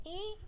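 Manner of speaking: small high squeak; thin, quiet creaking noise; imitated bed creak. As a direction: squeak softly like creaking bedsprings. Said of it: "the bed creaking".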